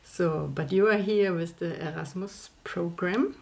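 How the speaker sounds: ordinary talk, nothing marked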